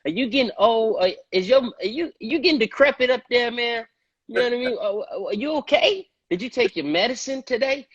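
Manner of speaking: ribbing the director like he's gone senile; incredulous teasing. Retold or imitated. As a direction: ask if he's lost his mind, jokingly. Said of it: "I'm like".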